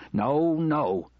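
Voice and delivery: understated shouted refusal; mild shout. A narrator's reading. Husband stopping his wife calling police, shouting mildly. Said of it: "shouted Mackenzie".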